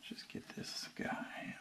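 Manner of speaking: soft unvoiced slow whisper. Real, not acted. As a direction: whisper it while concentrating. Whispered while placing bacon.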